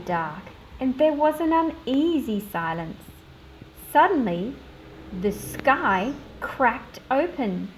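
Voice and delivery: put-on measured read-aloud storytelling for children; story narration. Teacher reading a picture book to her class, storytelling voice.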